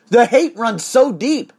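usual speaking voice

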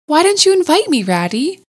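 high, loud complaint to a friend; mock-offended protest. A narrator's reading. Otter to rat at the picnic, mock-offended protest. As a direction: protest with voice leaping up, then dropping on the name.

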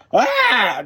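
high loud shout of effort; battle cry. Acted. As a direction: yell as you lunge in. Fighting the bear.

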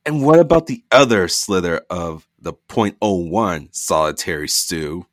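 mother snapping at her son. Acted. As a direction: snap back at her son. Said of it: "Dina snapped".

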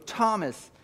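rising, swelling declaration; Jesus declaring. Acted. Preacher as Jesus telling Thomas who he is. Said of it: "he goes".

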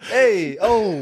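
hammed-up, high Italian-American holler; drawn-out mock Italian wiseguy; Italian-American accent. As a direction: ham up a New Jersey Italian accent. Joke round of Italian-American accents.

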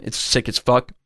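ordinary delivery

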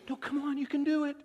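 put-on high, sing-song baby talk; crouched down coaxing a baby to walk; coaxing a toddler. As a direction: coo to a toddler taking first steps. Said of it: "You get down and you go".

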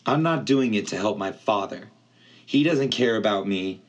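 ordinary delivery

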